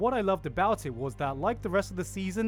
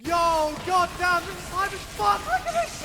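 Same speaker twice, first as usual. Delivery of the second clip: inner hype outburst; 13 semitones higher, 4 dB louder than usual. Acted. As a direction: explode with over-the-top fan hype. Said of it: "in my head, I'm like".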